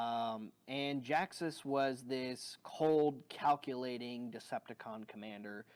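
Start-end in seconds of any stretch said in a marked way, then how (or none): none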